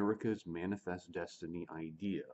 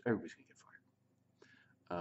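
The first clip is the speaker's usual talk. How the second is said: whisper. Real, not acted. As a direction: whisper "everybody's gonna get fired" as an aside. Whispered repeat of prior line.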